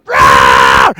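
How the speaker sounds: loud growling roar; loud bear growl. Acted. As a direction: let out a big bear roar. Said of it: "and Bear growled".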